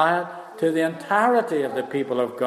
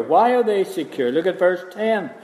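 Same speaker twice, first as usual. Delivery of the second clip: normal talking voice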